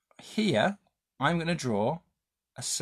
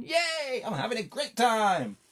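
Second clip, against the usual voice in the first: squeaky, much higher cheerful cartoon voice; happy giraffe; 9 semitones higher, slower than usual. Teacher voicing the drawn giraffe for kids, high and cheery. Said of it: "he's going".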